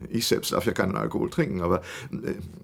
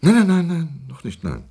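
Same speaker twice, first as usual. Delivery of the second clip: louder, higher rush of nos; hasty denial; 5 semitones higher, 7 dB louder than usual. Acted. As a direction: brush the question off fast and emphatic. Old man hastily denies.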